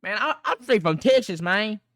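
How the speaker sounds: high-pitched, badly faked Texas country accent. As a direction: have a go at a country Texan accent. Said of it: "how to do a country accent".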